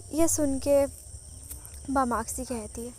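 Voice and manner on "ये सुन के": ordinary delivery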